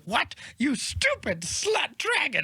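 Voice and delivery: high, slow, mock-furious scolding; appalled wizard. Wizard berating his wayward dragon, appalled. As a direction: imitate an outraged owner, each word drawn out.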